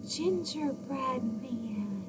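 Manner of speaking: hushed, breathy finish; whispered line. Pretend. Storyteller whispering to the children. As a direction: whisper it like a secret. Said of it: "what did he say?".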